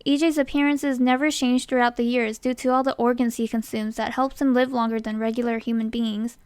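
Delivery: ordinary delivery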